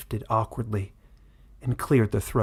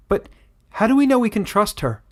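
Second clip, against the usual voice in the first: much higher, loud woman in the crowd; doubtful village woman; 11 semitones higher, 6 dB louder than usual. Acted. Village woman doubting the foreign queen, high and loud. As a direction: challenge the stranger with alarm.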